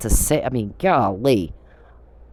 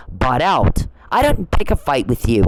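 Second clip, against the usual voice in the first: ordinary talk, nothing marked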